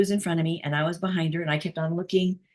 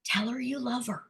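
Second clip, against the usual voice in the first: retold whisper; 4 semitones higher, 6 dB quieter than usual. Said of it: "he just whispered in her ear".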